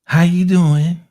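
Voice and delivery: high, loud, drawn-out greeting; shirtless dancer's greeting. Retold or imitated. Shirtless dancer greets the newcomer, high and loud. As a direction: put on the dancer's flashy hello. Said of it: "he goes like this".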